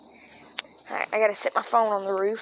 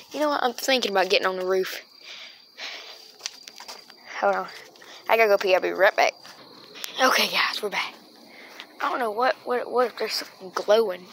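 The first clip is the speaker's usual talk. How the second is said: normal talking voice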